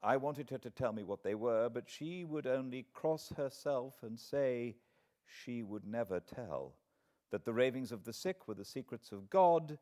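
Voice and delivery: usual speaking voice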